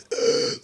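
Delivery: sharp unvoiced gasp for air; demo gasp. Shown as a demonstration. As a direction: gulp air like surfacing from water. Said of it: "think about that visual".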